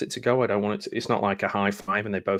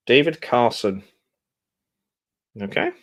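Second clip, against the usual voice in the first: normal talking voice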